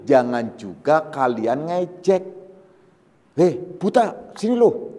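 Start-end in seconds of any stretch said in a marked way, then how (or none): 3.4-5.0 sneering call at a blind man; mocking taunt. Teacher imitates a bully jeering at a blind man, sneering. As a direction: jeer it out like a bully. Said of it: "ini kan intonasi".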